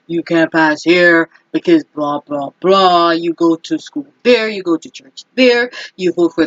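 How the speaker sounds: lightly voiced bossy gang member; a hint of a street gang voice; mock gang talk. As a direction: toss off the gang's rules in a casual mock voice. Mocking gang-style rules.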